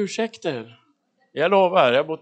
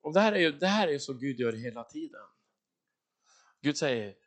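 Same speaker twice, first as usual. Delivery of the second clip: normal talking voice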